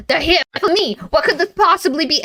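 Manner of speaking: high, retold panic as the realization hits; mock alarm. Watson alarmed in retold story.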